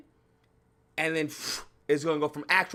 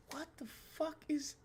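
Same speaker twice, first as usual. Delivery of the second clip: hushed disbelief; 8 semitones higher, 16 dB quieter than usual. Retold or imitated. Hushed stunned quote.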